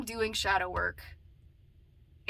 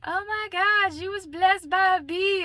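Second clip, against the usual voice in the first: bestie quote; 8 semitones higher, 3 dB louder, slower than usual. Retold or imitated. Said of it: "she was like".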